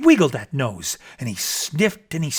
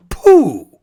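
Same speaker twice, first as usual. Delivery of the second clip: loud, higher scornful snort; snorted pooh; 8 semitones higher, 11 dB louder than usual. Acted. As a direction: scoff it away. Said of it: "the bear snorted".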